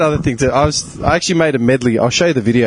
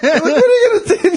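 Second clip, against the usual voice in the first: high-pitched, laughing retort; mock protest. Retelling the handbrake stop to his friend, high mock protest. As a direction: squeal it in mock outrage.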